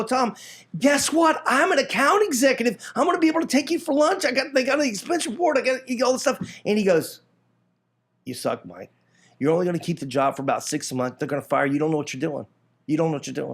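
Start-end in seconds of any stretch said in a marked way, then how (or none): 0.0-6.4 high, fast, giddy news; excited bragging. Retold or imitated. Telling best friend Tom about the new job, giddy. 8.3-13.5 dismissive put-down. Retold or imitated. Best friend Tom belittling his new job, low and dismissive.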